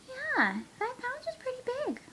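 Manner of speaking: high, fast cooing. Real, not acted. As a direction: chirp it up high. Cooing at puppy.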